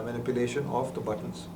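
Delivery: normal talking voice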